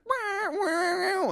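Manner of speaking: high wailing wah imitation; squealing car-horn noise; mock car horn wail.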